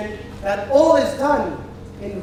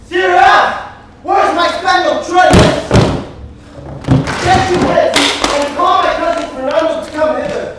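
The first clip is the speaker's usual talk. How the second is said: loud, higher bellowed orders; commanding shout; 4 semitones higher, 6 dB louder than usual. Acted. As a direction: summon the house with a roar. Master shouts orders at servants.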